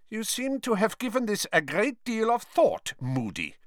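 high-pitched, coolly disdainful remark; cold. A narrator's reading. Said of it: "said Karkaroff coldly".

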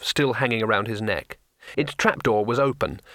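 usual speaking voice

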